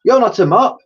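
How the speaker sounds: a surprised cockney cleaner; cleaning lady voice. Acted.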